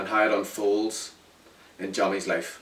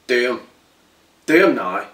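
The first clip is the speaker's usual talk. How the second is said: order shouted; 8 dB louder than usual. Acted. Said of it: "I heard one of them shout".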